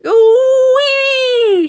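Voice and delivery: wild sing-song hag shriek, very high and loud; screamed. A narrator's reading. Grandma after the second dose, shrieking. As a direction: shriek with mad joy. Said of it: "she screamed".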